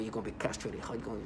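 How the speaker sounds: low, hushed mock threat; imitated menacing voice. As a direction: imitate him softly and low. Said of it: "talk to you in this way".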